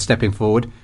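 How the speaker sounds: plain reading voice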